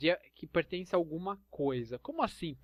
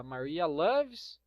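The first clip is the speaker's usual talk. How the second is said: slow model sentence; much slower than usual. Shown as a demonstration. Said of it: "Porque dela é her".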